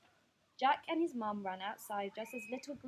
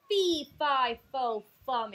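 cried out; 3 semitones higher, 10 dB louder, slower than usual. Acted. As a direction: bellow it word by word. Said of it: "it cried".

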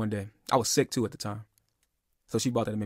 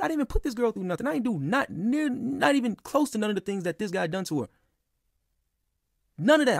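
indignant disbelief; 10 semitones higher than usual. Retold or imitated. Outraged retelling of the accusation.

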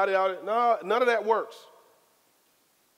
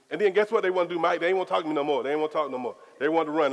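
ordinary talk, nothing marked

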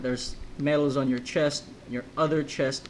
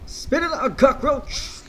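put-on much higher, barking Russian-accented general; 10 semitones higher, 4 dB louder, slower than usual. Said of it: "I have no idea why I use that accent".

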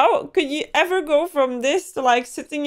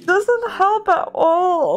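slow, tearful, louder words; crying; 6 semitones higher, 5 dB louder, slower than usual. Real, not acted. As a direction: say it through real tears. Crying over mother's letter.

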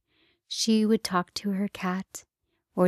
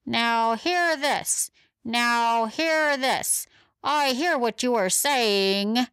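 higher, slow megaphone announcement; broken megaphone; 5 semitones higher, slower than usual. Acted. X-ray dog announcing through a broken megaphone, slow and higher. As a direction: boom it out through a busted loudhailer. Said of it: "through the red megaphone that was broken".

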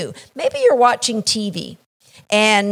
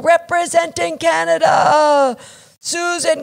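higher, announcer-style name call; 6 semitones higher than usual. Retold or imitated. Announcer introduces Susan at the championship, a higher ringing call. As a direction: boom it out like the arena announcer. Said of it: "the announcer announces".

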